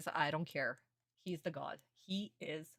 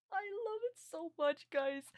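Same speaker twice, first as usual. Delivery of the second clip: high gushing; 13 semitones higher than usual. Real, not acted. Gushing over the panel.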